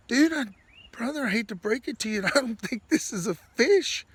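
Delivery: high-pitched, barely holding back laughter; laughing retelling. Retold or imitated. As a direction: break the news as your friend, cracking up. Teller laughs while voicing friend's verdict.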